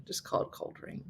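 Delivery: breathy muttered aside under her breath; whispered aside. Real, not acted. Muttered aside about weather words.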